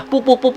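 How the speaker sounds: high, quick repeated "puk"; rhythmic mock impact sounds; imitated stabbing thuds. Meniru bunyi tusukan berulang. (tiruan bunyi tusukan berulang, nada tinggi)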